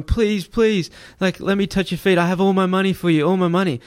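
high-pitched pleading, no tears; mock imploring, voice pitched well up; retold begging. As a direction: imitate a desperate man begging. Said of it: "starts begging and crying".

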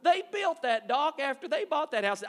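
heated retort at the TV realtor; indignant outburst. Retold or imitated. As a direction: call out the lie loud and deliberate. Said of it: "I said".

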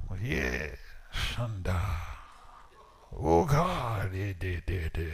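low, drawn-out mock praying in tongues. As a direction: imitate fervent tongues, stretching the syllables. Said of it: "My best tongues".

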